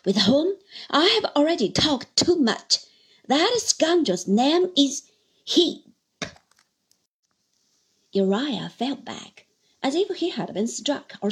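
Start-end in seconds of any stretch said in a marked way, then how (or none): 0.1-5.8 utmost vehemence. Acted. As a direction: build to the name and slam it down. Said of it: "breaking out again with utmost vehemence".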